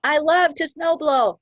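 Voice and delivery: high, slow and bright with delight; loving it. Shown as a demonstration. Coach showing the love way to say it, gushing high and slow. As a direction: gush over the chore like it's a treat. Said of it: "love, not fear".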